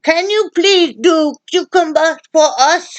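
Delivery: high, loud sing-song please; mock pleading. Begging her partner to do the cucumber, mock sweet and loud. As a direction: beg sweetly, hamming it up.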